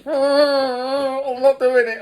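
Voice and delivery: hammed-up tearful refusal; mock whining refusal. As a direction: whine it like a sulking kid, very slow. Refusing the task, retold.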